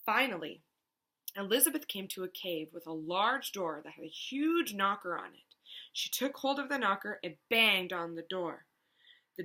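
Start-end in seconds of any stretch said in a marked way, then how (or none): none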